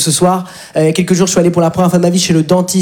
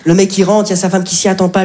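ordinary talk

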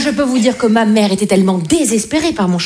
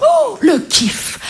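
delighted; 11 semitones higher, much slower than usual. Retold or imitated. Teen delight at record.

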